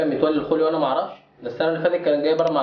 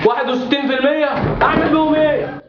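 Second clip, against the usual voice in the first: shocked outburst; 8 semitones higher than usual. Acted. Father learning his son's bad grade, shocked and high. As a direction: voice jumps high in disbelief.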